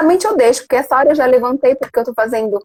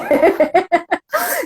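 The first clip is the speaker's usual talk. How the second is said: riso agudo; 5 semitones higher than usual. Real, not acted. Riso após a brincadeira.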